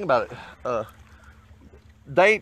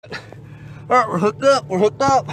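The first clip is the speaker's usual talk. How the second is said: excited; 5 semitones higher than usual. Real, not acted. Fisherman hooking a fish, calling out excitedly. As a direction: call out the hookup with real thrill.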